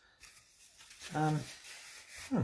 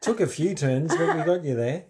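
laughing; 6 semitones higher, 4 dB louder than usual. Real, not acted. Gloating over triggering a yawn.

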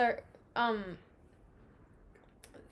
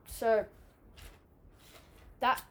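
usual speaking voice

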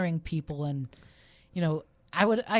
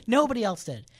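usual speaking voice

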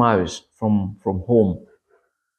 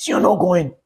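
stern refusal; 6 semitones higher than usual. Retold or imitated. Man repeats refusal.

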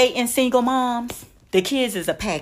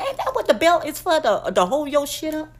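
ordinary talk, nothing marked

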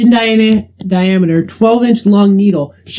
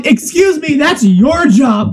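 indignant; 7 semitones higher, slower than usual. Retold or imitated. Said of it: "And I go".

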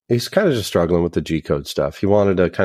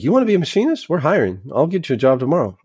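high, excited voice pitched way up; eager offer; 8 semitones higher, faster than usual. Retold or imitated. Classmate eagerly offering him a machinist job, excited and high. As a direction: sell it like a sudden great idea.

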